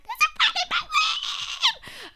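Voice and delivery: shrieked high and loud, slowed; panicked shout. Retold or imitated. Teen shrieking to her family about a rat. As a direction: scream it in terror.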